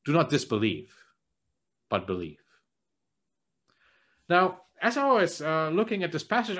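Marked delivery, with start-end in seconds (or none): 0.0-2.4 soft and low, ending very low; quiet and kindly firm, settling low; gentle rebuke. Retold or imitated. Jesus telling Thomas, lower and quieter. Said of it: "He says".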